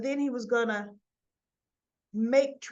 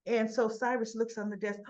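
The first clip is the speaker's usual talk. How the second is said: ordinary talk, nothing marked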